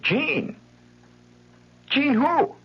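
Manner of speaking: put-on very high, girlish puzzled call; puzzled Patty. Girl calls from her room, baffled by the name. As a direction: act as if you've never heard of him.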